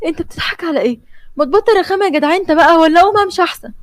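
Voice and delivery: high, fast, fed-up outburst; irritated woman losing patience; exasperated. Acted. انت بتضحك على ايه؟.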